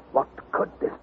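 whispered in awe; hushed, breathed question; awed whisper. A narrator's reading. Narrator awed by the monster.

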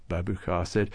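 ordinary delivery, nothing marked

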